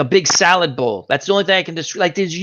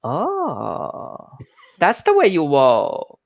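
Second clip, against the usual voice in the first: a light, slow imitation of the old ladies; old Vietnamese ladies; much slower than usual. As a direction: lightly mimic the knowing old women. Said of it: "They're like".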